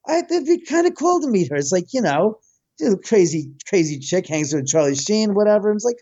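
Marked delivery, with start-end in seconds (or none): 0.1-1.6 pitched way up, lightly mocking; imitating a casual eager friend; high mock quote. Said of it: "Lindsay was like".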